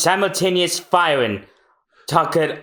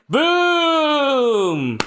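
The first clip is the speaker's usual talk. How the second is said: cannon boom; 11 semitones higher than usual. Pretend. Mimics cannon.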